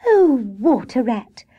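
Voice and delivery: high, drawn-out glad greeting; pleased, lingering welcome; delighted cry. A narrator's reading. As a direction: cry out slowly with pleasure at a friend. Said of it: "she cried when she saw her friend".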